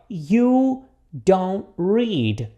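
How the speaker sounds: very slow, long gaps between words; repeat-after-me model. Shown as a demonstration. Teacher asks students to repeat the negative, very slowly. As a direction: draw out every word for learners to copy. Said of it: "repita comigo aqui".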